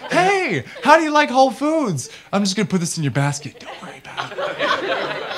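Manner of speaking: high, sly sneaky shopper voice; slipping soap into a stranger's basket, high and sly; mock sneaky salesman.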